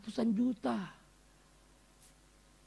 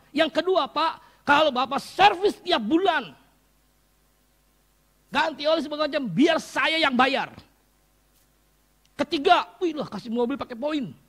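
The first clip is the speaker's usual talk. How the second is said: high, loud, jubilant preaching; excited preaching; 8 semitones higher, 11 dB louder than usual. Acted. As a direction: list the blessings, getting louder. (khotbah penuh semangat, tinggi dan keras)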